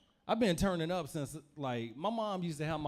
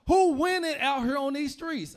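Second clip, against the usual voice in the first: gleeful boast; 8 semitones higher, 6 dB louder than usual. Retold or imitated. Boasting about being paid.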